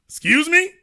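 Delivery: high, sharp disbelieving question; can't believe it; incredulous. Real, not acted. Host reacting to article, disbelieving.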